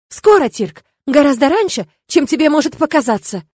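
pointed, agitated warning; angry retort. A narrator's reading. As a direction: snap back at husband indignantly. Angry mother in parents' quarrel.

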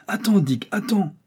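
high-pitched plea to hold off; urgent call. A narrator's reading. Doctor stops Dick from firing at the elephant, urgent call.

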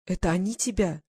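understated tearful question; nearly crying. A narrator's reading. Glasha asks who hurt him, nearly crying. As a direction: ask with a touch of distress. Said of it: "Она чуть не плакала".

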